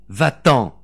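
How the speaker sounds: loud, forceful dismissal; shouted order. A narrator's reading. Mother with revolver aimed at the woman, shouted order. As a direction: cry out to drive her away. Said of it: "cria Véronique".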